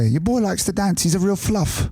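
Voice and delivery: put-on English-accented father, high-pitched. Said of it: "Oh, it's English, I guess".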